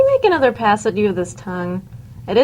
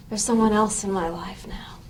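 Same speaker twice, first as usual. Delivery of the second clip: whisper; 3 semitones lower, 6 dB quieter than usual. Acted. Confiding a secret to Jenkins.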